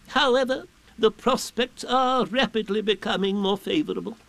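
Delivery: high-pitched, pompous owl. A narrator's reading. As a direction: pronounce it grandly in a high owl voice. Owl makes pompous forecast.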